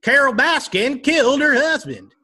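high, slow Oklahoma twang imitating Joe Exotic; hammed-up Oklahoma accent; Joe Exotic drawl. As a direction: do Tiger King's Joe Exotic. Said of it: "start talking like".

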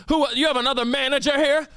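high-pitched irritated demand; imitating an indignant customer wanting a manager; angry old man. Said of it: "he's just angry".

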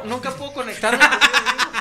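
laughing. Real, not acted. Telling friends he can never connect with himself, laughing. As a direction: laugh at your own failed meditation.

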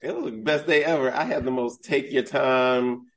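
usual speaking voice

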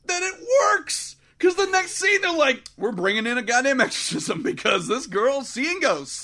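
hammed-up disbelief at the plot; incredulous outrage. Real, not acted.

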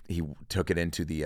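normal talking voice

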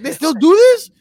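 hammed-up shocked little brother; mock amazed brother. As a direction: squeak it out like a stunned kid brother. Brother's amazed question retold.

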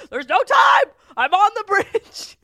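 high panicked yell; frantic shout. Retold or imitated. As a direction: shout it like there's no time. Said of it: "And I was like".

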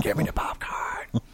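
breathy unvoiced whisper; creepy whisper. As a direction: whisper it like a stalker. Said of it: "movie scene retold".

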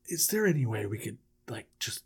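low, hushed, hesitant suggestion; muted plotting. Acted. Brothers plotting to bury him somewhere, low and hushed. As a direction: float it quietly, almost whispering. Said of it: "And they're like".

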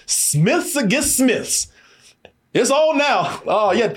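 slow, high, drawn-out movie-trailer line; dramatic showdown line. Retold or imitated. Retelling a tense showdown scene.

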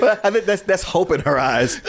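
ordinary delivery

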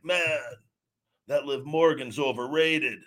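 lightly voiced scoffing fan; a hint of a sneering fan; mocking fan. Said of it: "I never say, like".

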